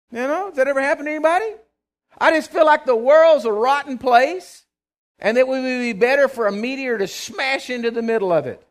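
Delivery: loud and high, pressing hard; full-force preaching. Acted. Preacher confessing dark mornings, full-force preaching.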